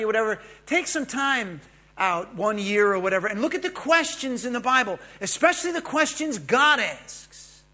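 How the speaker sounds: fired-up preacher pressing his point; preaching at full force. Acted. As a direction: pound home each question to the congregation.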